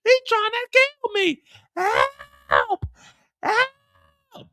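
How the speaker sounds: high, loud cries for help; terrified screaming. Acted. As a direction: scream for help as bullets fly. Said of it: "She's like".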